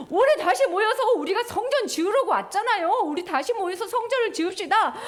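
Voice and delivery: high, bright call with a "영차" work chant; eager exhortation. Acted. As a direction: pump everyone up to build. Crowd exhorting, heave-ho chant.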